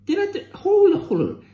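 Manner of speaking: startled high yelp of disbelief; jolted surprise, voice jumping up; sudden double-take. Real, not acted. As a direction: catch yourself mid-sentence, shocked.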